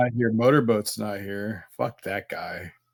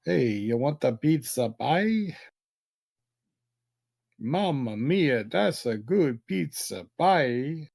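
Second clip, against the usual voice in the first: slow, hammed-up Italian accent; jolly Italian pizza chef; 4 semitones higher, slower than usual. Pizza talk, Italian chef bit.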